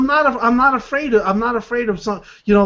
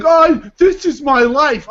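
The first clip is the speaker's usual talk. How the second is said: slow, louder mocking whine; mock self-pity; 5 semitones higher, 5 dB louder, slower than usual. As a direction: ham up a pitiful sob story.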